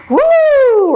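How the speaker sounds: high, loud whoop at the rudeness; sharp woo. Real, not acted. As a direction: let out a yelping woo, as if struck. Reaction to an escalating retort.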